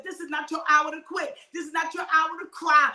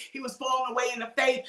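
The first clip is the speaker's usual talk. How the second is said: normal talking voice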